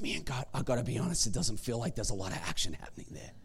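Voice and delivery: very soft, low, mostly whispered; early-morning prayer murmured under the breath; hushed whisper. Shown as a demonstration. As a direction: whisper so the kids don't wake. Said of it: "but I'm gonna whisper".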